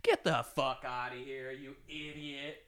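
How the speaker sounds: hushed, strained put-down; mock tough talk. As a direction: imitate a bully under your breath, voice climbing. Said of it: "this type of like".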